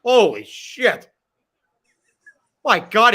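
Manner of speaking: loud, slow stunned exclamation; real shock at the hit on screen; excited. Real, not acted. Fan yelling at a brutal tackle, loud and stunned. Watching a big hit live.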